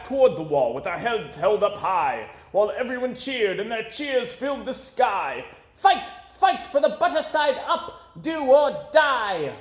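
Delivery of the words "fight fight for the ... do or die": rallying cheer. A narrator's reading. Crowd cheers them off.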